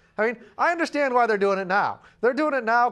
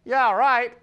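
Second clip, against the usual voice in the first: loud, higher mocking yeah right; sarcastic disbelief; 5 semitones higher, 7 dB louder than usual. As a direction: scoff at the claim. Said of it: "people would go".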